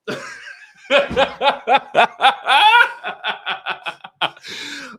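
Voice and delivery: long, loud, high cackling laugh; helpless burst of cackling; hard real laughter. Real, not acted. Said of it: "I ain't never laughed so hard".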